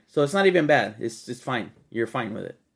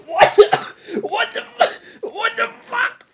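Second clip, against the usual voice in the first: shocked outburst; 4 dB louder, slower than usual. Real, not acted. Smelling strong ammonia, shocked.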